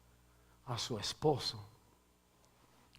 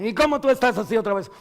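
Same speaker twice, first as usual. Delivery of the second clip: scolding parent; 9 semitones higher, 11 dB louder, faster than usual. Retold or imitated. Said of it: "En el hogar cuando llegan".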